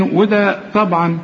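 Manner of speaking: ordinary talk, nothing marked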